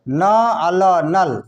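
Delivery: न, अ, ल spelled out slowly, then blended into नल; slow spelled syllables. Shown as a demonstration. Teacher spelling नल for students, slowly. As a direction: sound out न अ ल, then join them as नल. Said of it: "उसी जगह पर न लिखिए".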